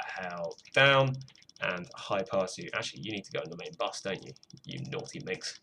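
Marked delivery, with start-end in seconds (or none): 4.6-5.6 low, hushed naughty tease; teasing dirty talk. Pretend. Musician playfully scolding a misbehaving track, low hushed tease. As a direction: drop low and soft, mock flirting. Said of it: "not a good phrase to say".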